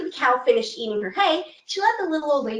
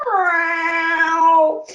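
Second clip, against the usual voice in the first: cat meow; 3 semitones higher, 5 dB louder than usual. Acted. Cat stretches and meows.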